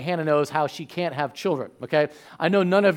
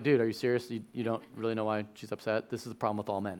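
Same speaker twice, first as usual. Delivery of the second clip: muttered, lower, softer disbelieving aside; a scoffing audience member rolling their eyes; sarcastic listener; 8 semitones lower, 11 dB quieter, faster than usual. Retold or imitated. Imitating people scoffing at Elkanah, muttered and lower. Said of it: "most people are going like".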